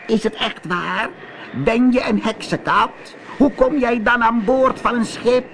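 high-pitched witch voice, questioning; calling sea witch. Acted. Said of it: "De zeeheks hoorde hem en riep". (roepende zeeheks met hoge stem)

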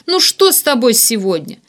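high, loud, building appeal; desperate pleading. A narrator's reading. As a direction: beg with mounting indignation. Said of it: "взмолился Варданян". (отчаянная мольба на повышенных тонах)

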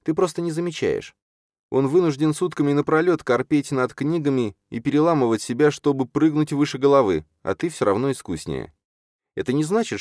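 put-on high, earnest young character voice; insistent Чарна. Чарна tells Юрген he outdoes Хранка, insistent.

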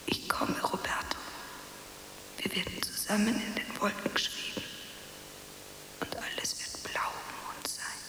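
put-on soft dreamy whisper to a lost child; delirious whisper. Mother whispering to her dead son, dreamy whisper. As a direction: whisper as if already drifting away.

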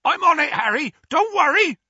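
high, loud yell to a rider behind; big hollered reassurance. A narrator's reading. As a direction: shout it high and urgent as Hagrid. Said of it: "Hagrid yelled".

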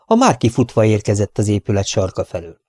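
usual speaking voice

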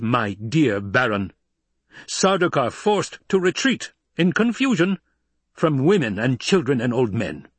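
high, loud, imperious harangue; indignant Emperor. Acted.

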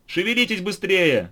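high, loud hurry-up shout; impatient shout. A narrator's reading. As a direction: shout to hurry them along. Figure urges them on.

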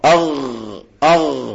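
أغ held on a voiced gargle; ghayn demo. Shown as a demonstration. Teacher shows an upper-throat letter, ghayn demo. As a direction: let the غ of أغ rumble at the top of the throat. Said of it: "وبعدين الغين والخاء".

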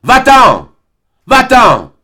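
high, very loud, furious screaming; violent shout. Acted. Albine driving Serge out, shouting violently. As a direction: hurl him out of the garden. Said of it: "elle lui cria violemment".

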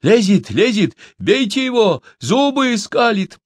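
high, urgent mob cries; very high, alarmed shouting; crowd shouting. A narrator's reading. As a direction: egg the mob on in a high yell. Crowd yelling at captive climbing out.

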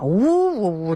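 drawn-out mock boo-hoo wail, swooping up; imitated wailing. Doctor retelling how his patient burst out crying, imitated wail. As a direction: imitate someone bursting into sobs. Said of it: "呜呜呜的就哭啊".

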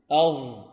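loud "أظ" with a heavy, held voiced th; emphatic zha. Shown as a demonstration. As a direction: thicken and hold the "أظ" sound. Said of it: "الذال والثاء والظاء". (ظاء «أظ» مفخمة)

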